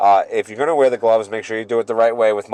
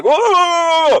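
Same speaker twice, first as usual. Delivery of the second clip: loud, very high mocking hoot; one long, shrieky run of whoas; seal-like whoaing; 6 dB louder than usual. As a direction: imitate him as a honking seal.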